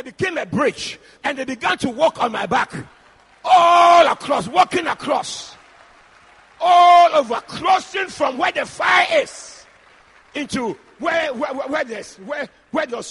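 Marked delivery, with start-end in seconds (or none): 3.4-4.5 "All across" loud and stretched out slowly; drawn-out emphasis. Real, not acted. Drawn-out shouted emphasis in dream story. 6.6-9.7 loud, high, stretched-out start; slow, raised-voice pulpit emphasis; emphatic preacher's shout. Real, not acted. Drawn-out shouted emphasis in dream story.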